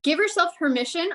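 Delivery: ordinary delivery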